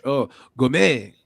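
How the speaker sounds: mock French, slow and drawn out; playful French Gomez; French accent.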